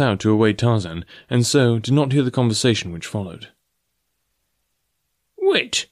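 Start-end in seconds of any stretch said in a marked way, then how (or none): 5.4-5.9 sudden high cry of wait; startled old professor calling out; cried out. A narrator's reading. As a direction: call him back in alarm. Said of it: "cried Professor Porter".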